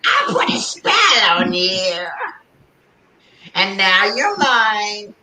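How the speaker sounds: high, slow cackling witch; hammed-up spooky witch, drawn out. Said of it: "She'd be like".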